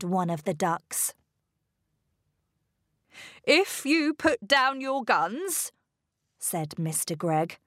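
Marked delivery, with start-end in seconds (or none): 3.4-5.7 higher-pitched, earnest bargaining; pleading. A narrator's reading. Father pleading with armed ducks.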